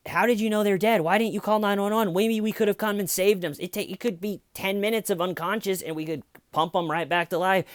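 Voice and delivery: high-pitched, urgent mock questioning; incredulous questioner. Acting out questioning of killers.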